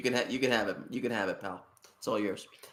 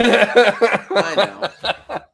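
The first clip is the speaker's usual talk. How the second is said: loud laughing high; 6 semitones higher, 11 dB louder, much slower than usual. Real, not acted. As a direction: laugh through the words. Laughing agreement.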